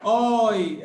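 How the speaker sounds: loud "Oi" with a long open O; som de ÓI. Shown as a demonstration. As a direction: belt out "Oi" as an open ÓI glide. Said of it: "esse som é o som de oi". ("Oi" forte, com o O bem aberto e esticado)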